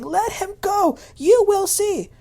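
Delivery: imitated high, loud scream at husband; wife screaming at her husband to let the guy go. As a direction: yell it high over him. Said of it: "Angela is screaming at her husband".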